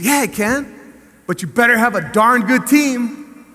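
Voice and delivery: building from firm to a loud high shout; rising passion. Retold or imitated. Passionate answer to the commissioner.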